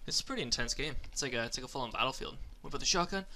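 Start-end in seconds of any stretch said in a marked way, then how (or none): none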